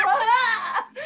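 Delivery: high, loud acted cry; loud comic wail; mock yell. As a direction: let out a retold yell, half laughing. Acted cry back at the yelling.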